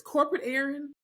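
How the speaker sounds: ordinary delivery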